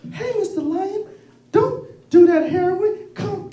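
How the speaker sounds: high, slow cartoon rabbit; squeaky little rabbit voice. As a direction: chipper high-pitched bunny. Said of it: "And the rabbit says".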